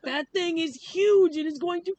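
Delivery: high-pitched comic freak-out; hammed-up panicky disbelief; alarmed outburst. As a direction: exclaim it way up high, in mock alarm. Acted alarmed outburst about the puma.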